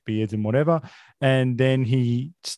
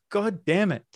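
screaming at a spider; 9 semitones higher than usual. Real, not acted.

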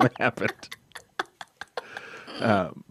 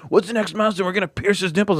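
mocking fan voice; 8 semitones higher than usual. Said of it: "mocking the fans".

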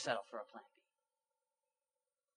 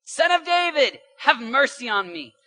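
loud, high cry rising from a blind beggar; shouted plea; 9 semitones higher, 12 dB louder, slower than usual. A narrator's reading. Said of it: "he shouted all the more".

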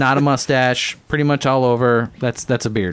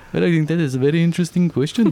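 quick, higher mock Indian accent; comic Indian-accented sage; Dalai Lama; 4 semitones higher, faster than usual. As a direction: answer in a put-on Indian accent. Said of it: "like, Indian accent".

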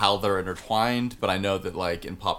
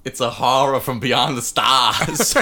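loud, slow, hammed-up Boston accent; broad comic Boston accent, drawn out; 3 semitones higher, 7 dB louder than usual. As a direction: cry out in cosmic dread like a Bostonian. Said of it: "That's their Boston accent".

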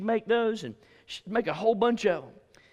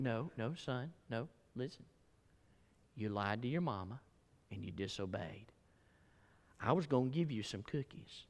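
much softer, low, firm rebuke; quiet scolding mother; 5 semitones lower, 9 dB quieter than usual. Retold or imitated. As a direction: lecture the boy calmly but firmly.

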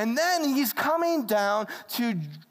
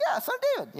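high-pitched throwaway mock delivery; easy, offhand; 8 semitones higher, much faster than usual. Shown as a demonstration. Blind man as Jesus just passes by, mock casual. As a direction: toss it off as if it were nothing. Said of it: "That's easy, just pass by".

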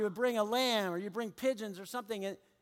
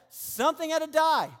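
higher and louder, leaning on die; forceful declaration; 6 semitones higher, 5 dB louder than usual. Acted. Pastor driving home the point to listeners, forceful.

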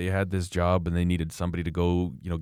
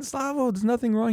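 much higher, light mock reassurance; mock reassuring. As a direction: toss it off high and breezy, brushing off his panic. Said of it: "I said".

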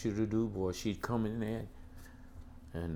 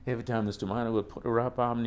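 normal talking voice

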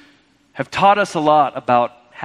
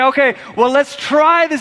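preached shout; 8 semitones higher than usual. Retold or imitated. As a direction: urge them on with a shout. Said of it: "and say".

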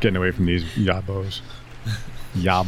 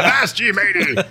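high, loud pirate shout; pirate yell; 13 semitones higher, 3 dB louder than usual. Retold or imitated. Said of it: "are you actually yelling like pirate".

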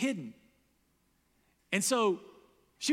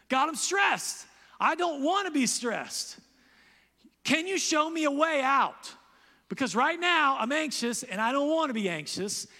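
high, loud anguished cry to God; stressed-out prayer; 7 semitones higher, 5 dB louder than usual. Shown as a demonstration. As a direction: shout it out like a desperate plea. Acted anxious prayer.

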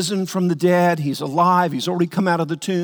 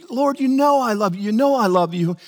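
higher, earnest plea of love; pleading Peter; 5 semitones higher than usual. Retold or imitated. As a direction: beg him to believe you. Peter answering Jesus, retold.

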